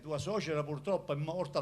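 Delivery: usual speaking voice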